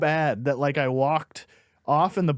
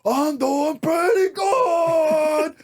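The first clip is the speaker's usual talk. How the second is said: much higher, very slow shouted sign-off; hammed-up closer; 12 semitones higher, 3 dB louder, much slower than usual. Bombing comic signing off to silence, over-the-top shout. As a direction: bellow the closer like a triumph. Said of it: "he goes".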